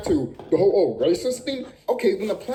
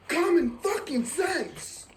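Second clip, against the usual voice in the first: slow emphatic catchphrase; 7 semitones higher, 3 dB quieter, much slower than usual. Real, not acted. Repeats catchphrase, drawn out.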